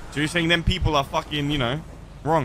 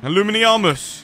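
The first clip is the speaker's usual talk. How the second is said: slow, louder, higher wizard's incantation; mock spell incantation; 6 semitones higher, 5 dB louder, slower than usual. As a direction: cast "Illuminiamus" like a magic spell. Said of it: "casting a Harry Potter spell".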